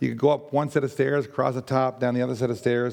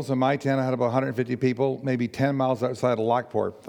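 normal talking voice

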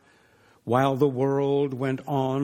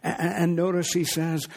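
ordinary talk

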